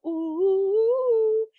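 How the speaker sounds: a loud, gossipy squeal of ooh; high loud ooh. Real, not acted. Reacting to soap drama with a loud high ooh.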